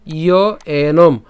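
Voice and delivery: slow, loud ইয় এনম, closing on m; pronunciation demo. Shown as a demonstration. Teacher shows how far to pronounce, slow ইয় এনম. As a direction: draw out ইয় এনম, ending firmly on m. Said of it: "আমরা এখানে এনম পর্যন্ত উচ্চারণ করছি".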